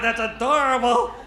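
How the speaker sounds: ordinary delivery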